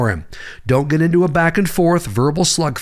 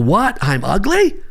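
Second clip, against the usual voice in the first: mock shocked yell; 6 semitones higher, slower than usual. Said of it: "run to the nearest mirror and yell".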